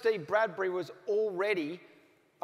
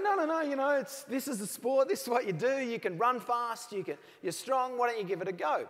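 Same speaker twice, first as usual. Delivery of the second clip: higher-pitched, coaxing teammate voice; eager persuader; 6 semitones higher than usual. Retold or imitated. Skater's teammate urging him to try bobsled, eager and coaxing. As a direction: talk him into it with rising eagerness.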